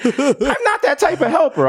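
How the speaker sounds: high, slow, mock-tearful whine; mock crying glove. Playing the glove, mock crying and offended. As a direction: whimper it like a wounded cartoon. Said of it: "he's crying".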